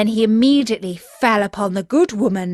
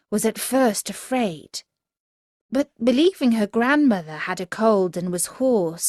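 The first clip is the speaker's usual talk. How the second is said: usual speaking voice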